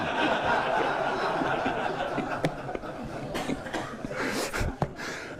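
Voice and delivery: long breathy laughing fit; real laughter. Real, not acted. Teacher at his own story's punchline, helpless laughter. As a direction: burst out laughing and let it run on.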